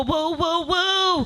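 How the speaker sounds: usual speaking voice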